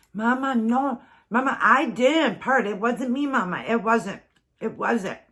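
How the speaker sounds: put-on a little boy protesting, near tears; whiny little boy. Mom voicing son who won't stop denying it. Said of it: "he goes".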